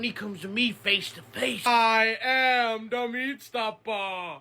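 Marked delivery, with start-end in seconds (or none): 1.7-4.4 dramatic catchphrase. Acted. Meat stopper squaring up to Dave, slow booming threat. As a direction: stretch every word like a movie threat.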